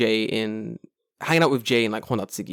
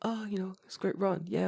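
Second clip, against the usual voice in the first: soft, higher polite filler; breathy small talk; 7 semitones higher, 8 dB quieter than usual. Retold or imitated. Runner chats politely mid-run, soft and high. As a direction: mumble politely while running, softer and higher. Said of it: "And I'm like".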